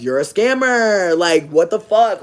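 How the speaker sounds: slow, loud accusation; outraged. Real, not acted. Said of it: "I'm getting animated".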